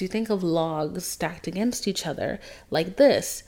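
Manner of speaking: ordinary delivery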